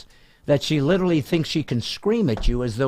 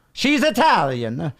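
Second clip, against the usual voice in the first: put-on Italian accent; 8 semitones higher, slower than usual. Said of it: "in an Italian accent".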